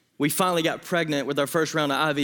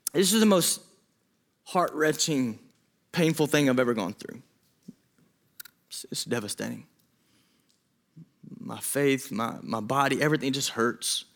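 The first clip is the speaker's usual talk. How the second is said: softer, lower retelling of her grief; quiet grief; 4 semitones lower, 3 dB quieter than usual. Retold or imitated. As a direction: let it sink to a near hush of pain. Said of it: "she said".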